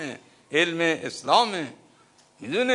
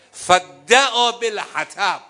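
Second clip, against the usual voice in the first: slow, loud, high chanted recitation of فدعا بالحطب; preacher recites the chronicle aloud, slow and loud; Arabic recitation; 7 semitones higher, 10 dB louder, much slower than usual. Acted. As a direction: intone the Arabic like scripture. Recites Arabic source text.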